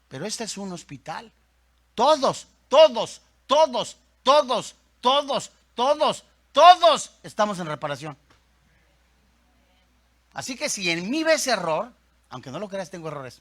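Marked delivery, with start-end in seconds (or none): 1.9-7.1 TODOS punched loud and high, each time separate; loud, higher, evenly repeated bursts on TODOS; emphatic repetition. Real, not acted. Hammers one word to congregation. 12.3-13.4 low, soft admission; said under the main line, lower and softer; quiet aside. Real, not acted. Low aside admitting faults.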